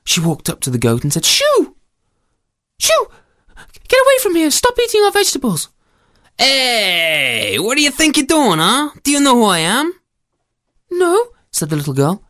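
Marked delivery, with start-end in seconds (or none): 1.2-5.7 put-on very high-pitched little girl; little girl shooing. Girl shoos the goat away. 6.4-9.9 menacing storybook goat. Acted. Goat challenges the girl. 10.9-11.3 put-on small high child's voice; timid little girl. Little girl answers the goat.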